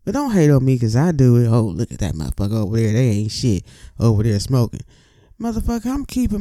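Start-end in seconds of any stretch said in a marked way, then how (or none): 1.5-4.8 lower, fast sneering voice of a judgy onlooker; a hint of a judgmental onlooker, fast and sneering; mocking a hater. Mocking critics who judge him.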